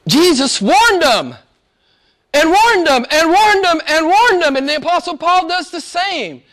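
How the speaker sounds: high, loud, hammered repetition; insistent preaching. Real, not acted. Preacher stressing to his congregation that Jesus warned, urgent and insistent. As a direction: drive each warning home at full force.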